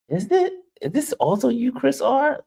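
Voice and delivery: high, rising disbelief; surprised. Real, not acted. Streamer asking a viewer if it's really them, surprised. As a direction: ask it in genuine surprise.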